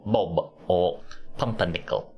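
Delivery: "Bob or Pumpernickel" with the B and P overemphasized; Atkinson plosives. Retold or imitated. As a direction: pop each plosive in "Bob" and "Pumpernickel" hard, like Rowan Atkinson. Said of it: "he does the jokes with, um, with his voice".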